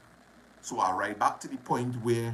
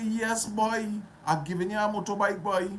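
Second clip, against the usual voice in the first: eager offer; 9 semitones higher than usual. Retold or imitated. Retold dialogue giving chain away.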